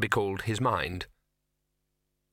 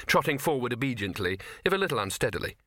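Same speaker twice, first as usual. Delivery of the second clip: ordinary delivery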